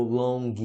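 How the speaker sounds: 'long' said as 'lon-ghee'; added G vowel. Shown as a demonstration. Teacher showing a learner's mistake, added G vowel. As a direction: add a hard G plus ee after 'long'. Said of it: "Não fala o G".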